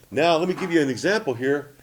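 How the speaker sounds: ordinary talk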